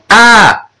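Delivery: high-pitched mock shriek; imitated scream. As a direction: narrator mimicking a small boy's cry. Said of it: "had run away from August going".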